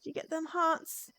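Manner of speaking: ordinary talk, nothing marked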